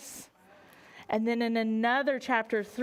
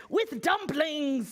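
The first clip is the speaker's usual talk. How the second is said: lightly voiced pirate; 4 semitones higher, much slower than usual. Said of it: "said another".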